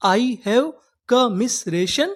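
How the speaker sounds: very slow, every word laid out; slow teaching demo. Shown as a demonstration. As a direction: model the sentence slowly for learners. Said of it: "English में क्या बोलेंगे".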